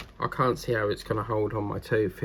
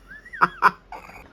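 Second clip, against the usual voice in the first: laughing; 9 semitones higher, 5 dB louder than usual. Real, not acted. Laughing before showing Jenny.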